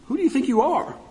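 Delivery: high-pitched taunting challenge; biting sarcasm. Retold or imitated. God confronts those who question him, taunting and sarcastic. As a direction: challenge them with a sharp rise in pitch. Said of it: "ripping into them with this biting sarcasm".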